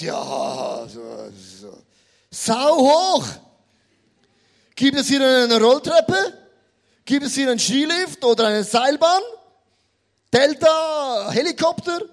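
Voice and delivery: calls out like a spoiled eaglet; whiny loud eaglet. Acted. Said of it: "Und er sagt". (lautes, quengeliges Adlerküken)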